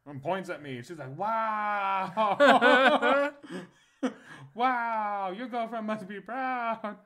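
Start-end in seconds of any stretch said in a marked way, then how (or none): 1.2-7.0 high, slow, laughing mimicry of the masseuse; amused masseuse imitation. Retelling the masseuse teasing him, high laughing mimicry. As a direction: play her teasing line while cracking up.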